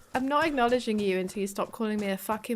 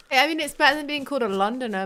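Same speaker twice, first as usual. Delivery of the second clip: fast and louder throwaway line; rushed aside; 4 semitones higher, 8 dB louder, faster than usual. Real, not acted. Streamer joking to chat about being called a Londoner, fast and loud. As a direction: rattle it off as a joke.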